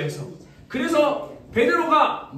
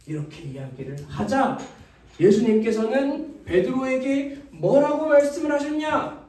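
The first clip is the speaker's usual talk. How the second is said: usual speaking voice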